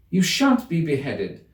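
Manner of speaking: slow firm reassurance; reassuring Alice. A narrator's reading. Alice protects the gardeners, slow reassuring voice. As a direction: promise them safety.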